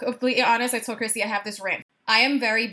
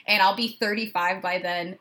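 ordinary delivery

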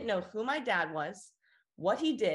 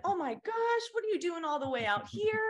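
tone changes to delight; 10 semitones higher than usual. Retold or imitated. Customer thrilled to meet a Carolina girl, delighted higher voice. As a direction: perk right up on hearing of home. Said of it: "immediately her tone changed".

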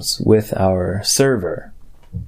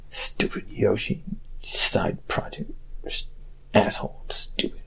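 much softer, whispered grumble; whispered aside; 2 semitones lower, 12 dB quieter than usual. Pretend. Host grumbling about cohost's side project in a whisper. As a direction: hiss it under the breath.

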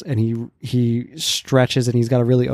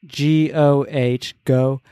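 G O H letter by letter, then go; spelling out; much slower than usual. Shown as a demonstration. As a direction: slowly spell the syllable, then sound out go. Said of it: "is how you'd say it".

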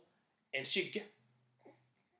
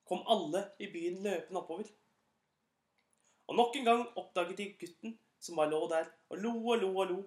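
ordinary delivery

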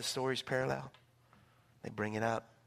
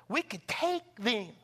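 proud boast; 6 semitones higher, much slower than usual. Retold or imitated. Spies boasting.

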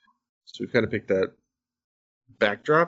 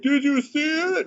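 put-on high, slow, wide-eyed kid asking; a hammed-up little kid; slower than usual. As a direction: play a tiny child showing off a fall. Kid voiced in a story.

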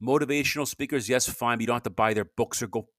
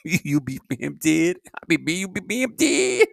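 higher, louder, slow put-on questioning voice; drawn out mocking imitation of a coworker's voice; slow rising put-on voice, clearly hammed up; 7 semitones higher, 7 dB louder, slower than usual. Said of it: "and then he got me saying".

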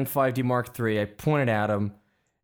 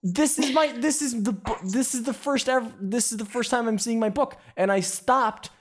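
much higher, pained reproach; upset scolding boss; 9 semitones higher than usual. Retold or imitated. Photographer scolds assistant for cutting his first book unboxing, high and upset. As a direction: let the hurt and anger spill over the lost moment. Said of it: "he's saying".

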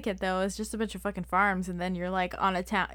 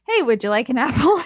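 loud, laughing as she offers an apple; 4 semitones higher, 11 dB louder than usual. Pretend. Joking with her friend about the quote, laughing.

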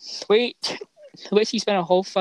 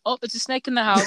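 teasing mom; 5 semitones higher, 4 dB louder, faster than usual. Retold or imitated. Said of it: "my mom would be like".

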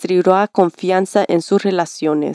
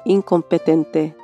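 calm them gently, line by line; soothing spa whisper. Acted. Leads a meditation. (voz suave de meditación guiada)

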